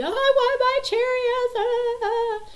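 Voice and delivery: loud, very high mock wail; piercing put-on sobbing wail; Hannah screaming and crying. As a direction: mimic a five-year-old's tantrum cry. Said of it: "Hannah starts screaming, crying".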